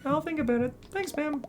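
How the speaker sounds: put-on high, eager young crab voice; high-pitched kid crab; young crab Krill. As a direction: play the small hero, bright and curious.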